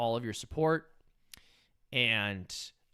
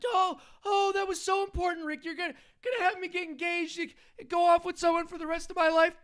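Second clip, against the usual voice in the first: put-on high, nervous whiny teen voice, way up in pitch; squeaky stammering teenage sidekick, pleading; Morty impression; 14 semitones higher, 5 dB louder than usual. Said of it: "doing a Rick and Morty impression".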